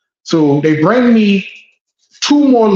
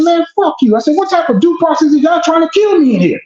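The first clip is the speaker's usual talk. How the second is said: higher, heated retort flung back; angry retort; 10 semitones higher, faster than usual. Retold or imitated. Defendant cursing out the stenographer, heated and higher. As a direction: snap back at them, raised pitch. Retold clash with court staff.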